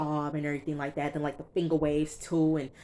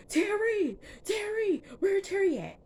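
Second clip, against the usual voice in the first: calling out much higher; mock shouting; slower than usual. Retelling Meech hunting for Terry, mock shouting. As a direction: yell down the hall for Terry. Meech yelling through hospital.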